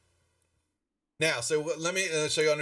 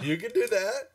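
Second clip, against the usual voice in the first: bright, high cheer; excited encouragement; 10 semitones higher, 4 dB louder than usual. Real, not acted. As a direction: egg the player on. GM repeats approval.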